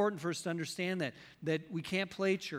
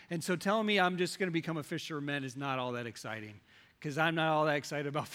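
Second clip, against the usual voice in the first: ordinary delivery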